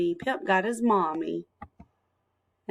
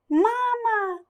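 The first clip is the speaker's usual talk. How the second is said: high squeaky duckling calling; baby duck; 3 dB louder than usual. Acted. Duckling calling Pip its mother, squeaky and high. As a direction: cry out like a newborn duckling.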